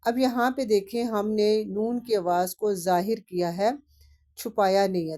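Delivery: ordinary talk, nothing marked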